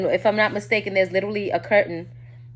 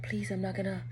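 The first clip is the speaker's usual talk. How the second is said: hushed, retold plea; imitated begging; 12 dB quieter than usual. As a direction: beg under your breath, imitating him. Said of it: "He's begging me".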